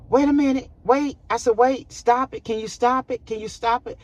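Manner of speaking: panicked plea. Retold or imitated. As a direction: beg the technician to stop it, panicking. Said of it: "I lost it".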